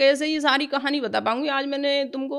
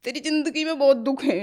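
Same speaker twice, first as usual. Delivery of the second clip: higher, emotional voice breaking off into a long pause; choked with emotion; 4 semitones higher than usual. Real, not acted. Devotee recalling her guru's comfort, choked with emotion. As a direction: overcome while passing on comforting words.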